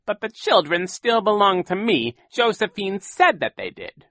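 high, loud greedy villain; sneering villain claiming the children; Count Olaf voice. A narrator's reading. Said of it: "Count Olaf admitted".

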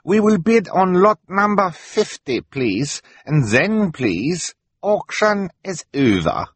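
high, slow, put-on foreign voice; fake broken English. A narrator's reading. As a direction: draw it out like a schemer faking poor English. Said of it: "pretending that he could not speak English properly".

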